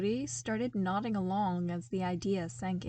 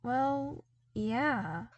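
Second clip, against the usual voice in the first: hesitant; 3 semitones higher than usual. A narrator's reading. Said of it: "he answered hesitantly".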